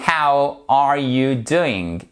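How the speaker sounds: slow, word-by-word "How are you doing", full "ow" in "How"; careful model pronunciation. Shown as a demonstration. Teacher showing learners the correct "How", slow careful model. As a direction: spell out "How" clearly, each word drawn out. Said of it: "Ce que je voulais vraiment que vous fassiez correctement".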